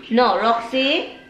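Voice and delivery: usual speaking voice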